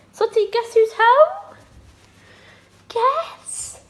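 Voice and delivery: high, slow sing-song pet talk. Real, not acted. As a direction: coo at the cat in a high voice. Greeting her cat on return.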